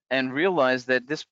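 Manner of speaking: ordinary talk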